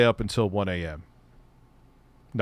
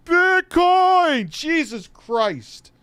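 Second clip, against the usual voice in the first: much higher and louder, then a slow groan; mock late-night yell; 8 dB louder, much slower than usual. Imitating a neighbour's night yell, then a slow shocked groan. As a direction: yell it, then slowly react. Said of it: "dude's just sitting up".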